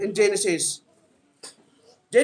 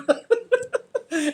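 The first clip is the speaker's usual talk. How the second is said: laughs; 8 semitones higher, 3 dB quieter than usual. Real, not acted. Laughing at own joke.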